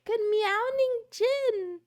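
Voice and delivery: high, sing-out cutesy greeting; playful high-pitched greeting voice; silly greeting voice. Pretend. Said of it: "why I'm talking like this".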